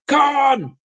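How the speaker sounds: high drawn-out mock cry; famous Khan yell. Retelling the famous Star Trek scene, high mock yell. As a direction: bellow the name like the movie. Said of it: "that famous scene".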